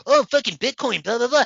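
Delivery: high loud dismissive bro voice; high, loud mocking bro; mock crypto bro. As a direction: parody his Bitcoin babble. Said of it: "He's like".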